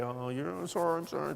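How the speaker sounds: soft, mumbled mock sobbing apology; half-voiced fake crying. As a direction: imitate a tearful wife apologizing under the breath. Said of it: "she's gonna cry and she's gonna say".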